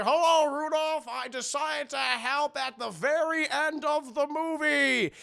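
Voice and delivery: high, booming jolly Santa. Acted. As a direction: announce it grandly as Santa. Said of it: "he's like".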